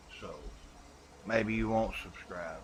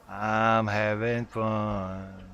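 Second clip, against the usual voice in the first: echo effect; 6 dB louder, much slower than usual. Shown as a demonstration.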